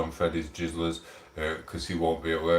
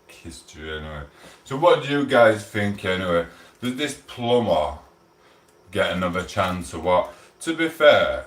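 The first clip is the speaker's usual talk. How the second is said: ordinary delivery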